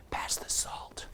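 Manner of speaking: whispered pass the salt; hushed mock whisper in a quiet venue. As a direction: whisper it like a forbidden request.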